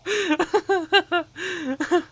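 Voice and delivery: high, helpless peals of laughter; uncontrolled laughter. Real, not acted. Player overcome by a funny story, helpless laughing. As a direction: keep cracking up.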